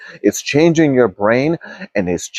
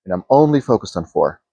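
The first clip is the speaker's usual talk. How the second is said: guided meditation. Acted. Coach guiding viewers inward, in a calm meditation voice. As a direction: guide listeners gently through each energy center.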